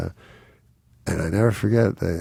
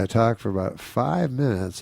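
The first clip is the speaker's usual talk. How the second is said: usual speaking voice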